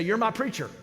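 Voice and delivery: light, happy imitation of the young man; eager young man. Pastor voicing the son greeting him eagerly, high and bright.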